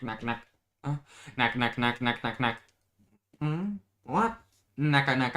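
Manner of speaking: usual speaking voice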